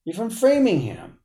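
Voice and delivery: high, incredulous mock of Worcester; scoffing you're from Worcester; mocking disbelief. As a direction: repeat it back, sneering at the claim. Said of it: "I'm like".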